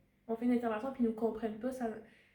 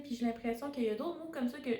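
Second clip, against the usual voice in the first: ordinary talk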